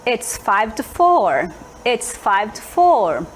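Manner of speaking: slow, careful repeated answer; slow clear answer. Shown as a demonstration. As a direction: drill the answer slowly. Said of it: "ela vai dizer". (resposta lenta e repetida)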